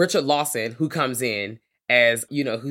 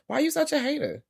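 much higher, incredulous mock whine; exasperated squeal. Host calling out the jealous villain, high and incredulous. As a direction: squeak it out in disbelief.

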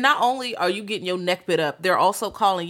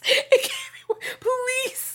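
mock wailing plea; much slower than usual.

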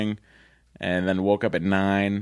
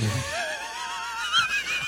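squealing laugh; 6 dB quieter than usual. Real, not acted. Reacting to hotel.

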